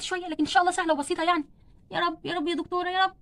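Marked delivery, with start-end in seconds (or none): 1.9-3.1 soft, strained plea to God; pained prayer. Acted. Patient in labour calls on God before the doctor, weak and pained. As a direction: pray weakly through labour pain.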